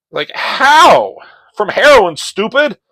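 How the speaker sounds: high, loud mocking scoff; high, loud sarcastic retort; sarcastic outburst. Real, not acted. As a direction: scoff at the obvious. Mocking exclaim.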